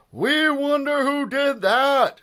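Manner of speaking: high, loud, slow group yell; crowd shout. Acted. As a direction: bellow it like a whole stadium. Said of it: "shouted the fans in the bleachers".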